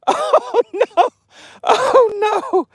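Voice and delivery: squealed, drawn-out oh no; very high comic squeal of dismay; high-pitched amused wail. Real, not acted. Cracking up at a chat comment.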